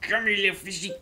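high coaxing fish call; talking to fish. Pretend. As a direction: coax the fish in, high and eager.

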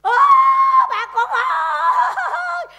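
slow, drawn-out high wail calling to passersby; hammed-up beggar cry. Shown as a demonstration. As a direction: wail it out like a street beggar begging for pity. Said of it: "Thí dụ như".

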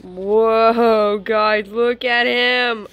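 loud thrilled reveal; excited shout. Real, not acted. First sight of new snake.

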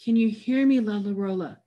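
acted breathless cry for attention; breathless call. A narrator's reading. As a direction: call out for someone who may not hear. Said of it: "called out in a breathless voice".

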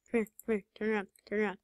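ordinary delivery

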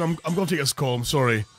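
ordinary talk

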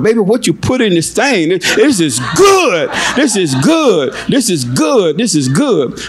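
high, swooping raves over the food; delighted husband. Acted. Husband praising his wife's hamburger, delighted and high-pitched. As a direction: savour it, drawing out each swooping exclamation.

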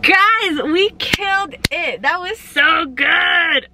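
slow, drawn-out celebration; elated cheer to the viewers; triumphant. Real, not acted. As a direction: stretch every word in delight. Celebrating after the call.

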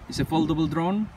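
ordinary delivery

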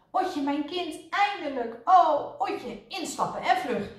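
high-pitched joyful greeting turning urgent; excited calling. A narrator's reading. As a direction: greet a child, then hurry them along. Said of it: "riep meneer Pijpentoon". (opgetogen roepend)

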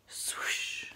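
long airy swoosh; whispered swoosh. A narrator's reading. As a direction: whoosh it out without voice, like fish racing off. Story sound of swimming away.

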